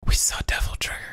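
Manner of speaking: unvoiced excited whisper. Real, not acted. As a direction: whisper it like a secret. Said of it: "whispered reveal".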